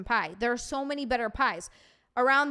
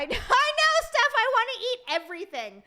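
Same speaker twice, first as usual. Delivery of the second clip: excited wail; 11 semitones higher, 5 dB louder than usual. Real, not acted. Streamer agrees with Steph about craving food, excited wail. Exclaims over food talk with viewer.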